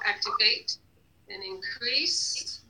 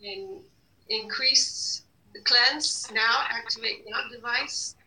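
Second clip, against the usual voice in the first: ordinary talk, nothing marked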